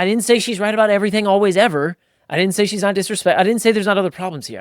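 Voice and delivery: dad loudly protesting he's not wrong; defensive protest. Retold or imitated. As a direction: push back hard, talking over.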